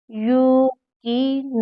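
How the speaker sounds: यूकिनून flowing slowly without a jerk; यूकिनून slow, smooth and even. Shown as a demonstration. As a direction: glide through यूकिनून. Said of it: "यहाँ झटका नहीं है".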